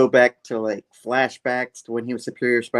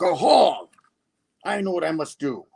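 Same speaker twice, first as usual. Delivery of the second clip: high, theatrical aha; villain's revelation; 6 semitones higher than usual. Acted. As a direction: announce the scheme with hammed-up triumph. Villain has sudden revelation.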